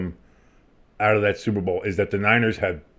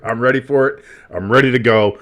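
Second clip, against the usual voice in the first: ordinary talk, nothing marked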